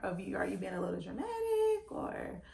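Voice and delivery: baffled, suspicious prodding of the doctor; confused questioning. Retold or imitated. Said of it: "And I was like".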